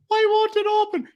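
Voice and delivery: very high imitated old-lady voice; put-on high, clueless question; mock old lady. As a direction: mimic a bewildered driver in a high squeal.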